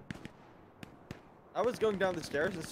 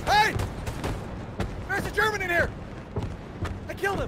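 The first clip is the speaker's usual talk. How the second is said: excited shout; 3 dB louder than usual. Real, not acted. Excited kill callout.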